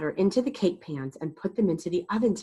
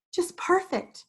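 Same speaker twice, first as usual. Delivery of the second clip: cooing grandma; 11 semitones higher than usual. Acted. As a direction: coo it with delight. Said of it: "Grandma cooed".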